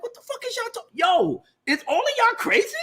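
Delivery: high, fast furious rant; outraged king. Retold or imitated. The king rants at the maesters, pitched high and furious. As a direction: scold them like they're all crazy. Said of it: "He was like".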